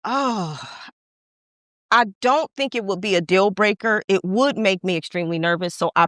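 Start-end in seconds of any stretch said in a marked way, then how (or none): none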